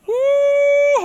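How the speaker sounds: a high, loud whoop of delight; excited high whoop. Real, not acted. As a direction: whoop like you just caught someone out. Preacher reacts to the scripture twist.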